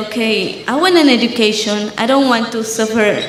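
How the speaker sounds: ordinary talk, nothing marked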